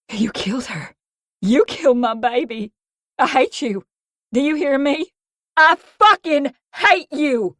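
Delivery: rising from tight to loud high fury; spewing hatred. Acted. Mia spitting hatred at Creed, building to fury. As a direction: build to a hateful shout. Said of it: "I spewed".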